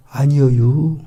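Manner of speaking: denial in a rural Korean dialect drawl with a rising end; Galilean dialect. Retold or imitated. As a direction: Galilean rural dialect: 아니여유 with a lifted tail. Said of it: "갈릴리 사투리 쓴다는 거죠".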